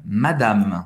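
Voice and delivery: "Madame" with a clipped final M, no added vowel; correct French pronunciation. Shown as a demonstration. Teacher showing how the word ends, to learners. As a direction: end "Madame" right on the M. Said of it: "acaba no M".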